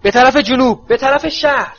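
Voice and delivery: put-on old auror shouting over the wind; Moody shouting. A narrator's reading. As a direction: yell the direction across the sky. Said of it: "مودی فریاد زد".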